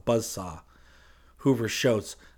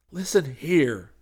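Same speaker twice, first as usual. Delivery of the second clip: slow, higher and louder warning; firm warning; 5 semitones higher, 5 dB louder, slower than usual. A narrator's reading. Hayes putting the preacher in his place, firm warning. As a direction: lay it down slowly.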